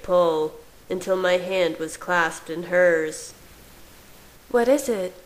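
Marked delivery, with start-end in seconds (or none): 4.5-5.1 higher, lightly louder questioning voice; questioning young wife. A narrator's reading. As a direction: ask in a high-pitched character voice. Voices the woman asking.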